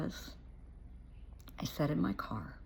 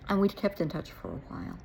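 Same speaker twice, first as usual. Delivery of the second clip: ordinary talk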